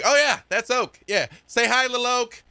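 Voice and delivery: put-on high, breezy kid voice; excited kid. Kid introduces little Oak to the party, excited high voice. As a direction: chirp it high, showing off a friend.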